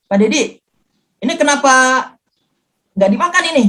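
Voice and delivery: imitating a nurse's reproach, voice rising; mild retold scolding. Nurse asking patient why food is uneaten, mild scolding. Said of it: "Saya sampai dimarahin sama perawat".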